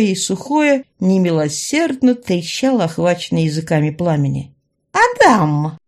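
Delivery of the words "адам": loud, high villainess's call; wailing call. A narrator's reading. Villainess calls out to the man holding back fire, wailing. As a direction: holler the name in a raised voice. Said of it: "вновь заголосила Раяна".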